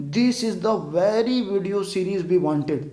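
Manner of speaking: very slow, drawn-out example; slow example sentence. Shown as a demonstration. Teacher reading the example slowly to students. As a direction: read every word slowly and clearly. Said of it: "आप लोग को क्या बोलना चाहिए".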